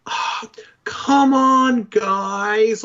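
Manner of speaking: high, drawn-out whiny groan; loud exasperated whine, stretching the words out; whiny Star-Lord impression. Player mocking Star-Lord's bored voice actor, in an exaggerated whine. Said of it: "His voice actor just sounds so bored".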